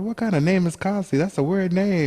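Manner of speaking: high, amused disbelief; incredulous friend. Retold or imitated. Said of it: "And she's like".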